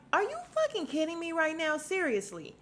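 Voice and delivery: high-pitched incredulous outburst; appalled disbelief. Retold or imitated. Voicing the dentist and wife staring, appalled disbelief. Acts out onlookers' appalled reaction.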